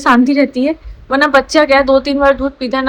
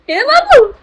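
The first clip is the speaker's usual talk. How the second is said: high coo; 11 semitones higher, 4 dB louder, slower than usual. Real, not acted. Cooing at baby.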